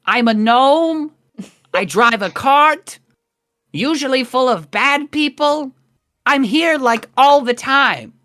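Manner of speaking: high-pitched, exasperated gnome; Eustace. Acted. Gnome driver insisting the guard knows him, high exasperated voice. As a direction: plead upward in a high little voice. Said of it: "Eustace stands up on the cart".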